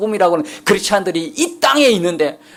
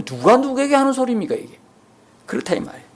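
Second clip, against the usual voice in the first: usual speaking voice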